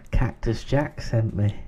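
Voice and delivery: slow, cheeky put-on customer; sly secret-menu order; sheepish customer voice.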